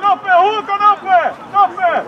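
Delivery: urgent shouting. Real, not acted. Coach urging a player to be quick, loud urgent shouts. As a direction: drive the player on with each shout.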